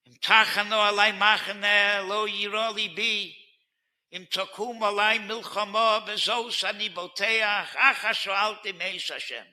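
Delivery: fervent preacher's chant of the verse; impassioned sermon delivery; preaching at full force. Acted. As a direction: proclaim the psalm to the whole room. Quotes psalm at full force.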